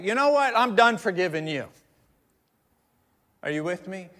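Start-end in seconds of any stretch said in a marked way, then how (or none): 0.0-1.7 loud, higher-pitched acted exasperation; exasperated outburst. Forgiver gives up on repeat offender, mock exasperated. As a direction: burst out as if patience has run out.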